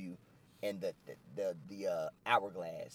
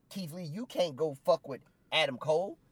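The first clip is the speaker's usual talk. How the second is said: high and loud, voice cracking up; heated call-out; 9 semitones higher, 6 dB louder than usual. Retold or imitated. Gargano yelling at Keith Lee, high and loud. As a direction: yell it like a fired-up wrestler.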